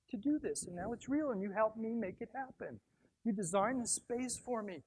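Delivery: grateful client sharing her dream, gently voiced; voicing the client. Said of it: "She goes".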